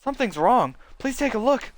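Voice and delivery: put-on girl voice. Same girl voice continues.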